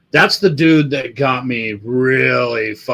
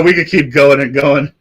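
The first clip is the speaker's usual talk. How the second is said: usual speaking voice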